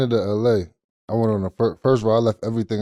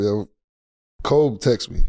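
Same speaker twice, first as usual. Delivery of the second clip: ordinary talk, nothing marked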